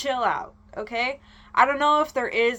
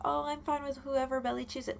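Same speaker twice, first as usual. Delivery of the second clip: mocking past self; 4 semitones higher, 8 dB quieter than usual. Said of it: "don't listen to me when I say".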